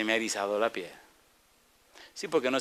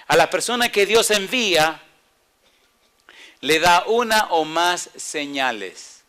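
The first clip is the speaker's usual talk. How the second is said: slow, loud emphatic point; slow emphatic preaching; 4 semitones higher, 6 dB louder, slower than usual. Acted. Preacher states point six slowly and loudly. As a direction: lay each word down slowly. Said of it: "slow emphatic point".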